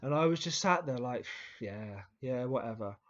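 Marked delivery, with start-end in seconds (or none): 1.4-3.0 low, unbothered brush-off; retold dismissiveness. Pupil sat through the teacher's telling-off, mock dismissive. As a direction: shrug it off under a sigh, lower.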